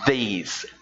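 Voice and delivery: These with a clear th at the start; teacher showing the th; th sound shown. Shown as a demonstration. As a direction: show the tongue on the th of These. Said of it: "Esse som do T H, olha lá".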